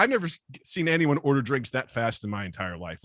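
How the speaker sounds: ordinary talk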